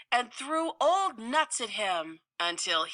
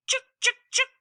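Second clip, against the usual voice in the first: high chattering squirrel scold; sharp high squirrel chuks; 5 dB louder, much faster than usual. Acted. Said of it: "she'd say".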